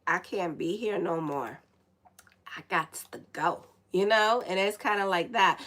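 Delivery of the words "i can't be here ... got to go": weary quote. Retold or imitated. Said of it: "And they're saying".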